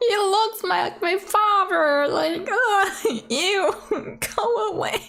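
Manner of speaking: squealing, laughing through disgust; high giggling recoil from a character she dislikes. Real, not acted. Laughing at the game, high and squealing.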